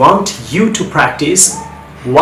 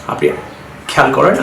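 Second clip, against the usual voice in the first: usual speaking voice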